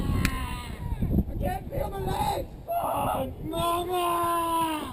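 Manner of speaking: hammed-up dying cry. Acted dying soldier cry.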